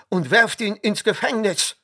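high, loud wrathful command; furious shout. A narrator's reading. Pontifar orders Josef thrown into prison, shouting. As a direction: shout the sentence in rage. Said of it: "schrie er".

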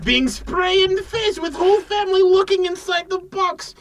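hammed-up foreign pitchman selling a box; broken-English pitch for a mystery box; mock foreign accent.

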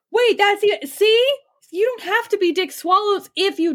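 high, sudden excited realization; gasp, then burst out high. Acted. Kyle gets an idea.

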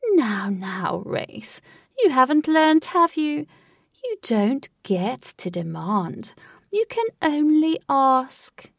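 loud, drawn-out condescension; mock-scolding villain. A narrator's reading. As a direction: chide him like a naughty child. Villain mockingly scolds captive.

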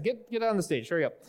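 ordinary talk

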